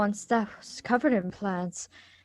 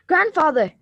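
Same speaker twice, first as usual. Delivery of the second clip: louder, higher call across the grass; 6 semitones higher, 7 dB louder than usual. Acted. Susan calling to her grandfather across the park, loud. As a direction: hail him from a distance.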